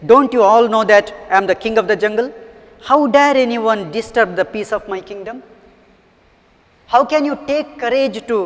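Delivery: teacher voicing an angry, loud lion. A narrator's reading. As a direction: scold loudly as the affronted jungle king. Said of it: "He spoke in a very angry, uh, way".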